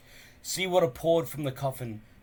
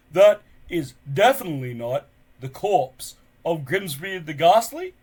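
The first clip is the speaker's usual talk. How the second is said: booming chief voice; 4 semitones higher, 10 dB louder than usual. Acted. Stoic questioning from under table.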